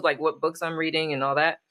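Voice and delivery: ordinary talk, nothing marked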